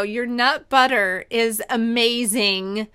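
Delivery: slow, drawn-out, deliberate praise; really slow. Real, not acted. Interviewer praising the founders' nut butter, really slow. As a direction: stretch every word out slowly for emphasis. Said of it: "I have to say this really slow".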